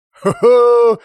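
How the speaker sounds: high, loud drawn-out cackle of glee; gleeful laugh. A narrator's reading. Alex as the vodka is brought, laughing gleefully. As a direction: crow with anticipation, high and loud.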